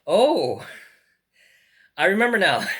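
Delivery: light up as it comes back to you; excited recognition. Real, not acted. Collector suddenly remembering the purchase, loud excited realisation.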